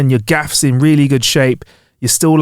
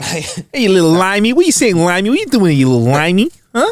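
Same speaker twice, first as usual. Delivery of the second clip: higher, cocky heckling rat; 6 semitones higher than usual. Pretend. Friend joins in as a rat mocking the limey, higher and cocky. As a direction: jeer like a streetwise rat. Joins voicing the New York rats.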